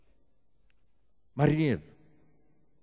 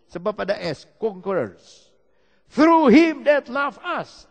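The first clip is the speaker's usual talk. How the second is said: preaching at full force; 7 semitones higher, 11 dB louder than usual. Real, not acted. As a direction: thunder the verse in English. (berkhotbah dengan penuh semangat)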